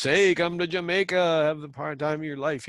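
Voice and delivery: hammed-up holiday ad pitch; ad announcer voice. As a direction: sell it like a cheesy travel commercial. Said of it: "You see the ads running".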